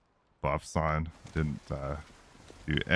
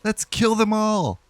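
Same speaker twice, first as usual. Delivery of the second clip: put-on much higher, resolved young priest voice; newly determined priest. Kiado vowing to fight the creatures, high and determined. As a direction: steel himself after crying. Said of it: "raspy from his sobs, but is filled with newfound determination".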